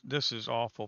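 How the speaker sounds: usual speaking voice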